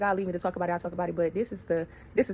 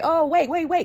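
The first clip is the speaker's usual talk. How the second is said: higher and louder, stop them; alarmed call; 8 semitones higher, 5 dB louder, slower than usual. Retold or imitated. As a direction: cut in urgently, loud. Cousin running out to stop it.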